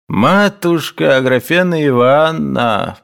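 slow, high pleading; pleading again. A narrator's reading. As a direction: repeat the plea, drawn out. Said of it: "повторил он". (умоляюще, снова)

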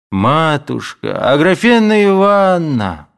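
high, drawn-out begging call; pleading. A narrator's reading. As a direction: beg her, stretching the name. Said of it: "начал он умоляющим голосом". (умоляющим голосом)

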